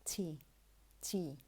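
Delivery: "T" with a puff of air after the t; aspirated English t. Shown as a demonstration. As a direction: say "T" the English way, breath on release. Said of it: "but in English, say".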